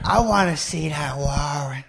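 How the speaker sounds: slow whisper, the line breathed out; whispered impression. Shown as a demonstration. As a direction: whisper the warrant line slowly. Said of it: "Very few people do the whisper when they do the impression".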